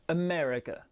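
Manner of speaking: "America" with a strong ME and weak other syllables; stress on second syllable. Shown as a demonstration. Teacher demonstrating word stress, "America" stressed on ME. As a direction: lean hard on ME in "America". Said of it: "c'est la deuxième syllabe qui est accentuée".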